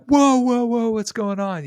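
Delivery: high-pitched mock alarm, loud at first; alarmed bystanders. Bystanders reacting to a man shoving in, alarmed. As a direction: act out startled onlookers, voice shooting up.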